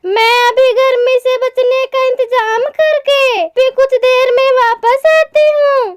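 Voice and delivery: high-pitched, caring storybook mother; concerned mother bird. Acted. (चिंतित माँ चिड़िया की ऊँची आवाज़)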